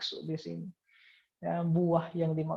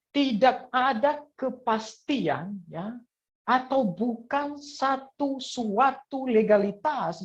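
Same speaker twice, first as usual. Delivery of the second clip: high and slow, each word leaned on; drawn-out pulpit emphasis; slow emphatic declaration; 6 semitones higher, much slower than usual. Acted. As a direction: preach it out slowly, pressing every word.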